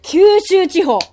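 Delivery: high, loud announcer call; excited winner announcement. Real, not acted. Announcing first place.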